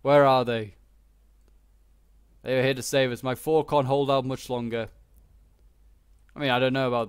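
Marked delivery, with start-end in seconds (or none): 0.0-4.9 worried general. Retold or imitated. Frightened general begging the French to come save them. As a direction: plead like a worried commander waiting for help. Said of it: "he's probably like".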